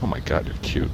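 barely voiced, whispering to himself; hushed aside. Real, not acted. Whispering to himself about a girl he sees.

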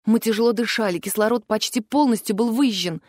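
usual speaking voice

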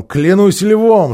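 loud, high, slow exclamation; full voice, no whispering. A narrator's reading. Тирион, done with whispering, swears by the lion aloud. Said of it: "заговорил уже не шёпотом".

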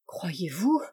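soft, whispery murmur; hushed, timid little question. A narrator's reading. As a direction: murmur it like a demure young lady. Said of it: "murmura la demoiselle".

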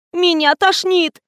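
high, drawn-out nauseous whine; queasy wail. A narrator's reading. Fatima fleeing to the toilet sick, high queasy wail. Sickened Fatima cries before running off.

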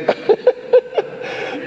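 high, breathless chuckle; laughing. Real, not acted. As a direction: burst of wheezy laughter. Teacher laughs at his joke.

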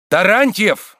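high, loud warning cry; menacing shout. Acted. Oblomov cuts off the insults, menacing shout. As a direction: shout the name as a threat. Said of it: "грозно крикнул Обломов".

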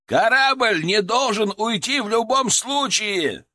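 громкий высокий медленный крик; прокричите, чтобы слышала вся команда; проорал. Acted. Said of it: "проорал я так". (крик сквозь шум вертолёта)

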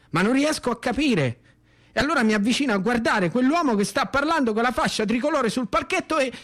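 ordinary delivery

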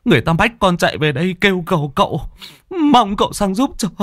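tearful plea climbing into a high wail; choked sobbing. Acted. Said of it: "khóc nghẹn ngào". (nghẹn ngào van xin, cao dần)

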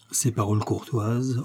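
ordinary delivery

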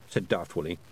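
ordinary delivery, nothing marked

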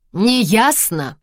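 loud high bark; harsh barking commands. Acted. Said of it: "рявкнул он".

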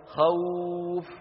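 خوف said very slowly, the و gliding long; drawn-out soft vowel. Shown as a demonstration. Teacher demonstrates the easy glide to students, slow. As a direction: let the sound in خوف flow out slowly. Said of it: "جربوا هكذا".

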